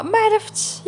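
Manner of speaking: high, worried reply; anxious. Acted. The other employee replying to worried Raja, anxious. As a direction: answer in a high, uneasy voice.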